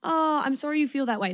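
non-apology, hammed up to show what not to say; fake apology. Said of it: "There's no".

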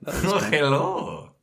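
a drawn-out Israeli hello, laughing; Israeli accent. Pretend. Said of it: "you should Hebrew accent".

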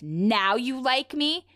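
high, drawn-out upset question; mildly hammed-up upset, stretched out; retold upset reply. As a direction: act out a hurt, indignant comeback slowly. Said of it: "Finn, very upset, replies".

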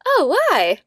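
high, loud and curious; mock eager interest; bright curious oh. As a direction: perk up with a loud high why. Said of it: "I'm like".

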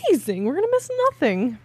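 high, fast, thrilled squeal; excited squeal. Retold or imitated. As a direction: squeal it out in delight. Retold delight at making it.

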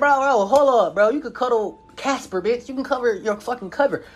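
high, incredulous ranting; exasperated protest. Retold or imitated. To the girl in bed, high exasperated rant. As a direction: complain at her, fed up.

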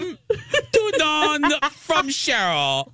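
hammed-up Chicago girl voice; Chicago accent. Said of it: "We do not talk like that".

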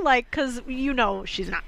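usual speaking voice

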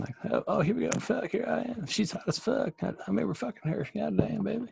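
high-pitched lecherous politician impression; Bill Clinton. Host playing Clinton ogling a woman, high-pitched impression. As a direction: push the voice way up for a randy ex-president. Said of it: "Let me find this Bill Clinton".

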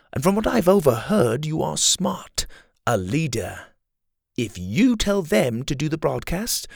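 a scheming rebel leader flattering his captive; Kai, smooth villain. Acted. Kai persuading Dylan.

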